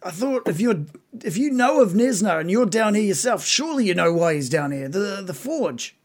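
slightly high, puzzled creature voice; puzzled bugbear. Acted. Captive bugbear answering his questioner, puzzled. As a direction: question them back, pitched a little up.

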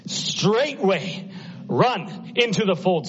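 impassioned sermon climax; preaching at full force. Acted. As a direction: drive it home like a revival preacher.

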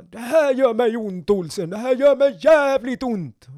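high, loud stern officer, pressing it out; scolding captain. Retold or imitated. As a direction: growl out the grievance like a furious captain. Captain scolding recruit at grading.

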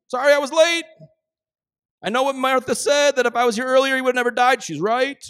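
high, loud, carefree voice; high, loud, breezy mock reassurance; mock breezy Jesus. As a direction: play him as casual and unbothered. Preacher mocks a breezy Jesus.

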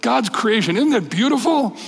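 high, gushing admiration; admiring friend. Retold or imitated. Friend admiring the farmer's field, high and gushing. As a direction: marvel at the field, voice way up. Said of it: "and his friend said".

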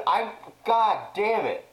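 ordinary delivery

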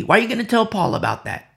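high, hurried reproachful questions; mock scolding. Church critics reproaching Chloe for reporting sins to Paul, mock indignation. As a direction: play the indignant critic chiding a tattletale.

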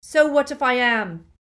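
loud, high defiance. A narrator's reading. Neville defying Voldemort, loud retort. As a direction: fling it back loudly. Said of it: "said Neville loudly".

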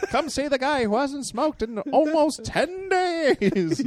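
high, slow barker call with a laugh; carnival barker. Acted. Barker boasting he hasn't smoked in days. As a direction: pitch himself like a sideshow.

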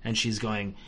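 ordinary talk, nothing marked

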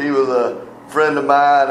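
usual speaking voice

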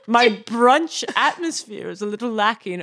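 slow, stretched lordly British demonstration; British accent demo. Shown as a demonstration. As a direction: draw out the British accent grandly. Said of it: "it's the hook phrase".

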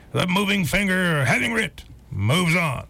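lightly voiced rough radio announcer; old radio newsman. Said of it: "this old rough radio voice".

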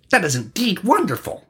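high-pitched storybook character voice; high, performed line of praise; Sauron. A narrator's reading. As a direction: lift the voice high in admiration. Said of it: "said Sauron".

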